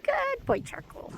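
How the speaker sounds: very high praise voice; dog praise. Real, not acted. Dog sitter praising Charcoal, very high.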